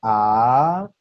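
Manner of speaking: long, loud rising "A"; second tone. Shown as a demonstration. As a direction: draw out "A" as it climbs like a question. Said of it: "Otra vez desde el inicio".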